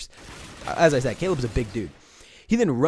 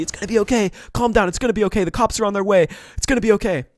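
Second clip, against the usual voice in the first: imitating friend reassuring; 7 semitones higher, 5 dB louder than usual. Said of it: "he's just telling me".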